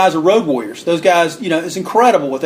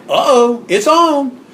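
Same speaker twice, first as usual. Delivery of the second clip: much higher, thrilled crowd reaction; gleeful anticipation of the fight; excited uh-oh; 8 semitones higher, slower than usual. Retold or imitated. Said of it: "And we're like".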